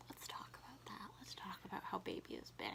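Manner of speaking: conspiratorial whisper; hushed teasing whisper; mock whisper. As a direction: whisper it like a secret. Whispered mock-secret aside.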